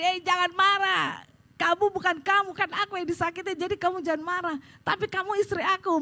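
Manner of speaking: acted-out insistent plea; pleading argument. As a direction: urge the husband to calm down. Retold argument with angry husband.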